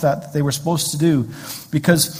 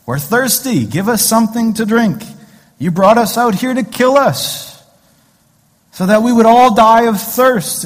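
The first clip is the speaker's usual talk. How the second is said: high-pitched mock whining; grumbling complaint; 7 semitones higher, 5 dB louder than usual. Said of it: "Grumbling and complaining".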